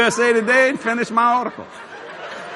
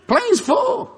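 pitched way up, calling out; high announcement; 13 semitones higher than usual. Retold or imitated. Getting up from the seat beside her, high-pitched exclamation. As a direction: exclaim it high, as a retold excuse. Calls out excuse while standing up.

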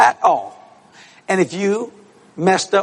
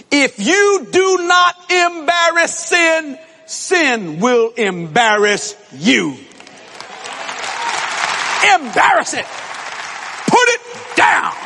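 high, slow, emphatic sermon climax; full-force preaching; 7 semitones higher, slower than usual. Real, not acted. Preacher closes on his statement to the congregation, full-force preaching. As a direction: drive each phrase home to the congregation.

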